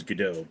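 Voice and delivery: Gadot as guh-DOH; French pronunciation. Shown as a demonstration. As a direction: leave the T in Gadot silent. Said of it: "it's French. The pr-, the correct pronunciation".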